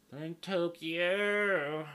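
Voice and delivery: high, loud, drawn-out country twang. Acted. Podcaster joking about the Olympics, loud country drawl. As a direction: stretch Tokyo out in a country accent. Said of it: "you guys get my country voice".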